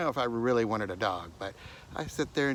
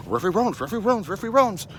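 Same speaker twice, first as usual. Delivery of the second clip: excited talking dog; 12 semitones higher, 12 dB louder, faster than usual. Retold or imitated. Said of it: "and he would get up there and go".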